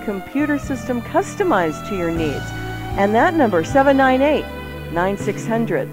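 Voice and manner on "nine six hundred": ordinary delivery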